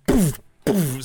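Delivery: two puffed, high "poof" hits; punch sound effects. Pretend. Game master voices Broco's blows, punch sound effects. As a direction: puff out two quick punch noises.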